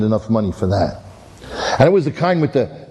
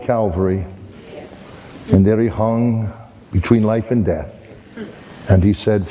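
tender preaching; 3 semitones lower than usual. Acted. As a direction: bring it home gently to the listeners. Preacher's closing appeal.

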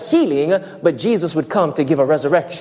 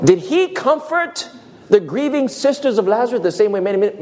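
usual speaking voice

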